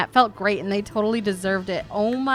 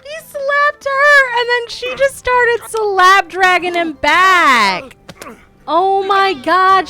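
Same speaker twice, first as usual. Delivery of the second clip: much higher, louder, slow and drawn out; amazed; 11 semitones higher, 7 dB louder, slower than usual. Real, not acted. Gamer narrating the slap fight, high drawn-out amazement. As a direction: savor each word in disbelief.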